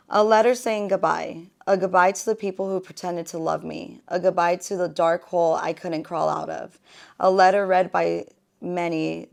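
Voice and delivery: spoken-word delivery in measured phrases; poem reading. Acted. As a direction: recite your own heartfelt poem with emphasis. This one's called Goodbye.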